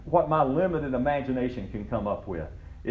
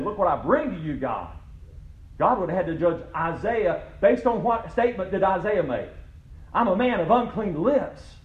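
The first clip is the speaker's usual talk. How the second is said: preaching at full force; 7 semitones higher than usual. Acted. Pastor to congregation on works and grace, preaching at full force. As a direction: preach it hard, pitched high over the congregation.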